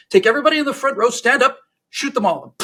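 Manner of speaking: loud, high, angry command; angry Stalin. Acted. Angry Stalin to his KGB guys, barked order. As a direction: order an execution like a furious dictator. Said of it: "Comrade Stalin is clearly angry".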